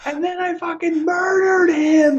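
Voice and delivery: slow, very high shriek of glee; hammed-up screeching confession; hysterical mock shout. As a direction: shout the punchline as if helpless with laughter. Acting the hysterical murder confession.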